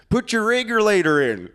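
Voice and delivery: high, put-on commanding voice; Russell. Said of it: "I'll never forget what he said".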